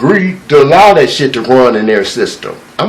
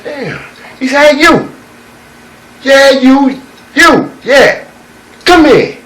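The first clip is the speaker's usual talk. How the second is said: put-on old eagle calling out; 10 semitones higher than usual. Old eagle hollering to the young eagle from his rock. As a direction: hail the young one over.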